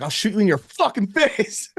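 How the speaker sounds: hammed-up stick-up line; mock robber threat. The threat he landed on for the clerk, mock menacing.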